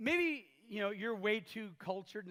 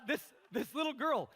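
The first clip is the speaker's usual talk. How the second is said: ordinary talk, nothing marked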